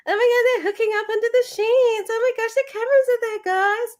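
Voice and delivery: high, fast, breathless reality-star gush; mock gushing contestant. Co-host mocks hookup-focused Big Brother housemates in a high, fast gush. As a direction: gush like a giddy housemate dishing gossip.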